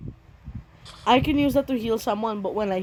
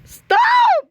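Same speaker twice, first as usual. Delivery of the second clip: panicked high shouting; being chased; 12 semitones higher, 6 dB louder, slower than usual. Real, not acted. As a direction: scream for help in panic. Panicked while attacked in game.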